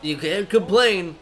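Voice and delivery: slow, put-on mimicry of naysayers; mock know-it-all newbie voice; mocking new players. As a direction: imitate the new players in a drawn-out voice. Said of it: "all the new players be like".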